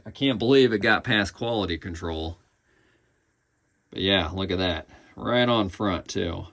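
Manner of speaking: normal talking voice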